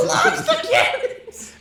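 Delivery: high, loud laughing yell; laughing shout. Pretend. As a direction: cry it out while cracking up.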